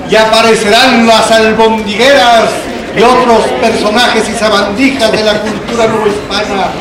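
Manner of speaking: loud, high, drawn-out fairground pitch; hyped fairground barker. Acted. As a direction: call the crowd in like a carnival barker. Calls people into theatre fair.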